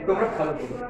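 ordinary talk, nothing marked

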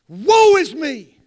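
loud, slow, high woe; preacher crying out Isaiah's woe. Acted. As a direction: shout the lament to the rafters. Preacher cries out lament.

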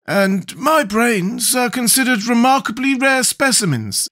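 high, slow, boastful character voice; proud Scarecrow. A narrator's reading. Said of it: "added the Scarecrow proudly".

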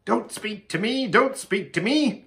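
high, pained pleading; acted fretful agitation; agitated outburst. A narrator's reading. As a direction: fend them off, frantic with thought. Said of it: "as if he had a pain in his inside".

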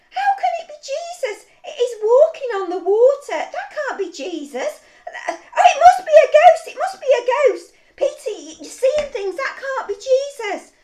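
loud, high panicky protest; alarmed disbelief. Acted. Frightened disciples contradicting Peter in the boat, loud alarmed protest.

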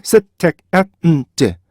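셋, 택, 앳, 음, 제 as five separate beats; staccato syllable-by-syllable demo; syllables split apart. Shown as a demonstration. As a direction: break it into five evenly timed syllables. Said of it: "이렇게 분리하셨잖아요".

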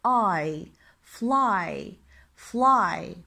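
fly ending in a drawn-out "eye" sound; fly, the Y sounded as long I; Y pronounced as I. Shown as a demonstration. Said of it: "حرف ال Y يلفظ I".

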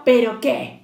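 loud, impatient fox retort; mildly cross storybook growl. A narrator's reading. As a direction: cut in gruffly and loudly as the fox. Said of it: "gruñó zorro".

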